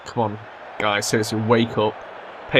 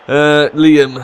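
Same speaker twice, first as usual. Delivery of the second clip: calling out; 5 semitones higher, 10 dB louder than usual. Real, not acted. Calling out to player.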